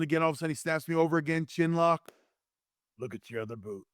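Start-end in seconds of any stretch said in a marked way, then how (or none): 3.0-3.8 very soft, low secretive aside; hushed imitation of a whispered tip-off; retold whisper. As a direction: murmur it confidentially in the ear. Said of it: "he whispers in my ear".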